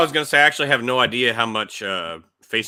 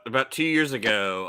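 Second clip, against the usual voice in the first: ordinary talk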